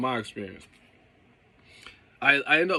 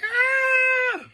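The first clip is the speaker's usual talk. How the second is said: high mock coaster scream; high screaming rider on the drop; 5 dB louder than usual. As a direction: let out a high thrill-ride scream. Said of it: "some people are screaming like".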